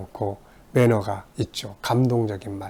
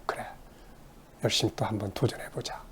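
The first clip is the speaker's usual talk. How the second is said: hushed, quietly determined; whispered resolve; 3 semitones lower, 14 dB quieter than usual. Retold or imitated. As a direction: promise yourself softly. Encouraged person resolving. (속삭이듯 조용히 다짐하며)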